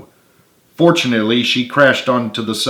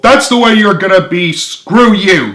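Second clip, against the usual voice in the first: higher, louder shout; 8 semitones higher, 6 dB louder than usual. A narrator's reading. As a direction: blow up at her. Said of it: "James shouted".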